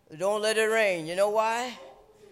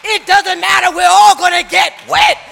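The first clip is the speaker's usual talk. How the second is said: loud, high preacher's cadence; shouting sermon to the congregation; full-force preaching; 9 semitones higher, 16 dB louder than usual. Acted. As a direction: preach it hard, driving each phrase.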